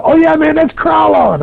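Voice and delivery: high, excited retold reply; excited coworker voice. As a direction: imitate a fired-up coworker. Coworkers excitedly naming local legend.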